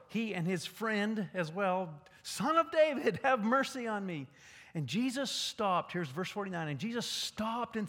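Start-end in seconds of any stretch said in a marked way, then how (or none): 2.2-4.3 higher and louder call; crying out louder. Retold or imitated. Blind man calls louder over the crowd. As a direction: shout over the crowd's hushing. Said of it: "he cried out all the more".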